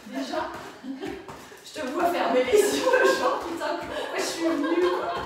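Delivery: amused, words breaking into laughter; teasing a pupil while laughing; laughing tease. Real, not acted.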